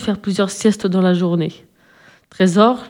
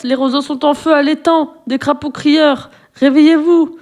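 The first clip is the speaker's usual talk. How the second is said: croaking at full lungs; 6 semitones higher than usual. Acted. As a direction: raise the whole forest with a croaking shout. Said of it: "se mit à croasser à plein poumon".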